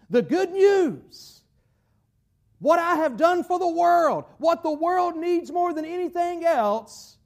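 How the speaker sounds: lifted, emphatic declaration; impassioned proclamation. Acted. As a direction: proclaim it as a divine charge. Preacher voices God's charge to disciples.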